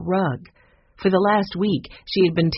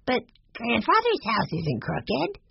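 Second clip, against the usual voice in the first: put-on high, slow little boy voice; slow, high-pitched kid's objection; puzzled Benny; 7 semitones higher, slower than usual. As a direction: puzzle it out like a small child.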